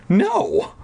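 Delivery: high gasping no; horrified cry. Acted. Wendy crying out in horror at the news. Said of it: "I cried, gasping in horror".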